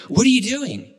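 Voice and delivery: high-pitched, incredulous demand; acted alarm. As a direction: play the startled man left holding the rope. Acted shocked man holding the rope.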